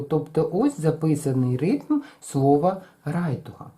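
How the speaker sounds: usual speaking voice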